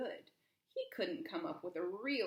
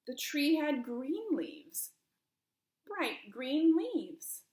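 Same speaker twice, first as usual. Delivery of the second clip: slow, louder and higher, each word weighed; slow dramatic reveal; 5 semitones higher, 6 dB louder, much slower than usual. A narrator's reading. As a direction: savor the big reveal. Dramatic reveal of green tree.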